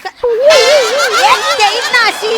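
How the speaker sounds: high, loud, drawn-out warning; angry threat. Acted. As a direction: threaten a slap, stretching the words. Threatens to slap him.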